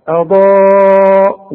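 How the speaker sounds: اضاء with light hamza and a loud, long, full ضا; heavy ض. Shown as a demonstration. Teacher shows the emphatic ض, long loud اضاء. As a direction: hold a thick ضا on one steady note. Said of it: "الضاد مفخمة".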